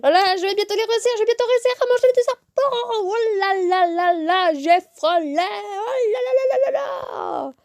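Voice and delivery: high, fast, hammed-up match commentary; mock sports commentator getting carried away; football commentator. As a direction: commentate the game like a frantic football caller. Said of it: "t'es des commentateurs de foot".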